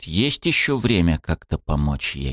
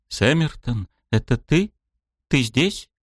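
put-on higher, uncertain young woman; princess voice; 6 semitones higher than usual. A narrator's reading. Blind princess calls out.

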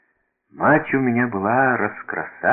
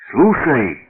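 sharp call for attention, higher and louder; soldier's shout; 5 semitones higher, 5 dB louder than usual. A narrator's reading. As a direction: call out as an indignant soldier, mildly raised. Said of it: "закричал солдат".

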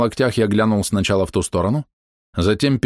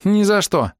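high, defiant female refusal; high-pitched retort; high-voiced refusing woman; 12 semitones higher, slower than usual. A narrator's reading.

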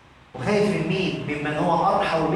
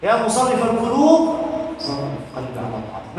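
supplication; 5 semitones lower than usual. Acted. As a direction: plead it like a supplication, swelling once then hushing. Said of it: "تحتاج أن تضرع إلى الله وتقول".

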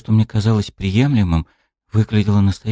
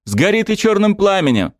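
spat curse; 12 semitones higher than usual. A narrator's reading. Dryad cursing the god with hatred. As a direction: spit the curse at him. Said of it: "Словно выплюнув последнее слово".

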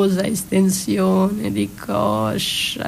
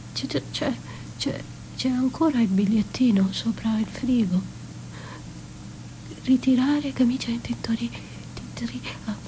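rapid, hurried whisper; fast whisper; 6 dB quieter, faster than usual. Acted. Reading the fridge note aloud to herself, in a fast whisper. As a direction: rattle it off under your breath, fast. Frantic inventory of her kitchen.

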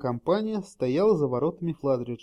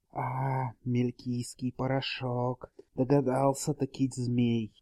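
hissing serpent dragon; 2 semitones lower, slower than usual. A narrator's reading. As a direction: hiss it like an old serpent. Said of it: "прошипел ведьмак".

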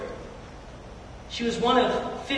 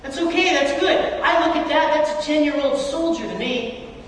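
a proud father's raised, glowing praise; proud dad praising; 6 semitones higher than usual. Retold or imitated. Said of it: "I'm a proud dad. I'm like".

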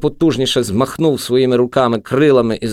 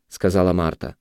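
ordinary delivery, nothing marked

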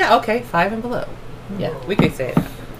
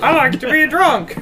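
talking Coke can; 5 semitones higher than usual. Acted. Host voicing a Coke can to friends, playful higher cartoon voice. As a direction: give a soda can a silly, tipsy little voice. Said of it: "I like to animate inanimate objects with voices".

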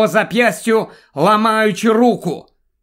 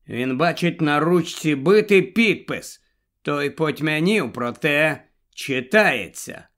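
usual speaking voice

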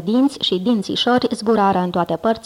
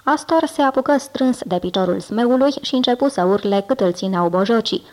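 ordinary delivery

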